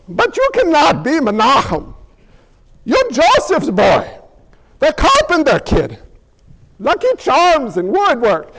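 put-on Irish villager; hammed-up Irish lilt of doubting townsfolk; Irish accent. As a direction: object in a comic Irish accent. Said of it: "I'll stop the accents".

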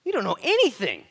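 high, very loud "anything"; full-force exclamation falling on "anything"; emphatic rebuke. Acted. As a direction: shout it, hitting "anything" hard. Preacher repeats rebuke, escalating.

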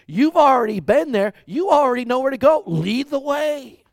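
high, loud mock frustration; exasperated loud. Preacher mocks his friend's delay, high and loud. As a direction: laugh it out, fed up.

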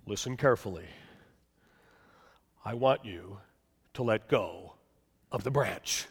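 low, calm voice of God; deep voice of God. Acted. God instructs the man to let go, low calm voice. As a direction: speak as God, low and measured. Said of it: "he hears a deep voice".

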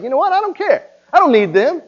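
high, loud mock-defiant voice; offended brush-off. Preacher mimics a resentful churchgoer, high mock defiance. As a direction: play the huffy offended friend. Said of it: "and you're just like".

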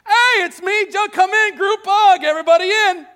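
high, loud gushing welcome; mock joyful reunion. Pastor mocks a gushing Joseph greeting brothers, high and loud. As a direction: burst out like an overjoyed long-lost brother. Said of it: "He doesn't run out to them and say".

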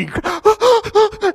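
high, loud convulsive sob-gasp; sobbing gasp. Shown as a demonstration. Demonstrating uncontrollable crying, loud sobbing gasp. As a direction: gasp like sobbing you can't stop. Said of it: "you know that kind of cry?".

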